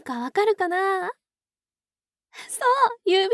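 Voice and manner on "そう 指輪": excited. Acted. Proudly names the ring.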